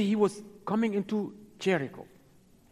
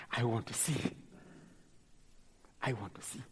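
low, hushed longing; softly acted request, voice dropped low; quiet plea; 6 semitones lower, 11 dB quieter than usual. Blind man telling Jesus his wish, low quiet plea.

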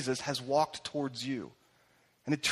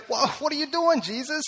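high-pitched, incredulous mock challenge; shocked objection; 10 semitones higher than usual. Religious leaders seeing Jesus with outcasts, shocked. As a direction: imitate taken-aback onlookers, pitch jumping up.

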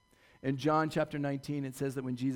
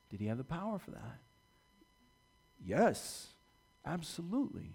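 ordinary delivery

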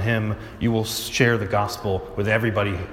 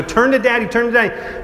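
put-on higher, louder, urging the frightened child; urgent coaxing; 11 semitones higher, 3 dB louder than usual. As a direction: call the crying boy to turn to his father. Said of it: "and I go".

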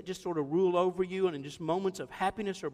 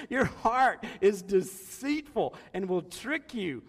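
laughing through it; slower than usual. Real, not acted.

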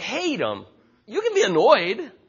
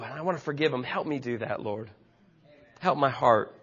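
spoken prayer; 4 semitones higher than usual. Acted. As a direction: pray it honestly before the congregation. Prays aloud as a model.

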